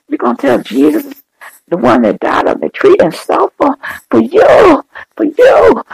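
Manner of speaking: real anguished, high-pitched crying plea; tearful preaching. Real, not acted. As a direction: preach through tears, voice driven very high. Tearful, impassioned pleading with congregation.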